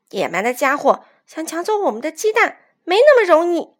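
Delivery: indignant, rising to a loud finish; angry defiance. A narrator's reading. Young rooster vowing to protect the eggs, angry. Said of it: "卡梅利多愤怒地冲了出去".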